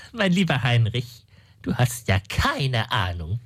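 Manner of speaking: usual speaking voice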